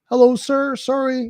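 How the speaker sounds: high, apologetic customer-service voice; flustered support rep. Retold or imitated. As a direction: squeak out an apology to an angry caller. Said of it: "and you're like".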